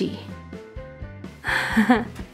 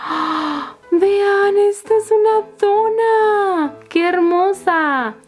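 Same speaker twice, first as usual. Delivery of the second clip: excited reveal; 9 semitones higher, slower than usual. Real, not acted. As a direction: show it off with delight. Reveal of donut toy.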